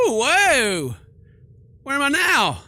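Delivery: loud, high-pitched yelp of surprise; startled shout. Acted. Player suddenly falls into darkness, startled high shout. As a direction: cry out in alarm and confusion.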